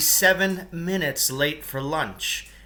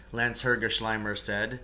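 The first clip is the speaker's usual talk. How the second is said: plain reading voice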